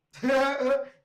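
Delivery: high, loud burst of laughter. Real, not acted. Joker amused by his own skit, loud laugh. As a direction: crack up at your own bit.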